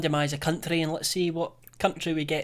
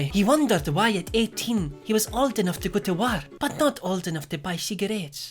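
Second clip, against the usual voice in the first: Mexican accent attempt; 4 semitones higher than usual. Shown as a demonstration. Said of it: "I've watched a few tutorials ... I'll try".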